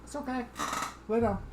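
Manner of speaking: high, soft pet-talk reassurance; soothing dog talk. Real, not acted. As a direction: settle the dog gently.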